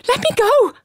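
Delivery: high, loud frightened scream; seized by a giant, she screams for release. A narrator's reading. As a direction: scream to break free. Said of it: "I screamed".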